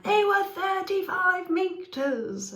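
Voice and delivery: hammed-up astonishment; mock amazed. Shown as a demonstration. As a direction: gasp it out as big news. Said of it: "It's not being like".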